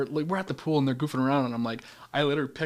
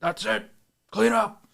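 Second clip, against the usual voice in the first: high, raised-voice command; imitated stern order; 8 semitones higher, 4 dB louder, slower than usual. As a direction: play the enforcer ordering kids to tidy. Acting out the regulator with kids.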